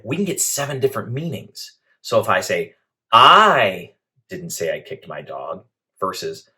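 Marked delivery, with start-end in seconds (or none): 3.1-5.7 first "I" drawn out loud, the rest slower and even; stress on I. Shown as a demonstration. Presenter showing how emphasis changes meaning, stress on the first "I". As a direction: lean hard on the opening "I". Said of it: "emphasize a different word each time".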